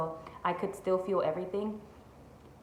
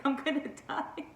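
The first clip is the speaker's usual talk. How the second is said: laughing repeat; 7 semitones higher than usual. Retold or imitated.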